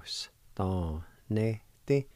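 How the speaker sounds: ordinary talk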